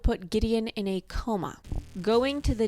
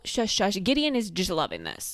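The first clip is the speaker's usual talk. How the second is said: usual speaking voice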